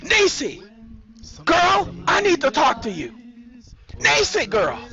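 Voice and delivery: high, urgent shout through a door; calling out. A narrator's reading. Sandra at Nisi's front door, calling out urgently. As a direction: call her out, high and insistent.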